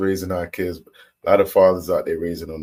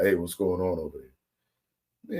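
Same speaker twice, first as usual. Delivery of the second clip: mock boss; 3 semitones lower, 5 dB quieter, slower than usual. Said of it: "bosses look up and be like".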